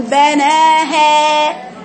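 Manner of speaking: "بناها" chanted loud, high and drawn out as a faulty example; imitated faulty reading. Shown as a demonstration. Teacher imitates how some read it, loud drawn-out "بناها". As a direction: mimic a wrong reading of "بناها", long and loud. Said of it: "بعض البعض بيقرأ يقول".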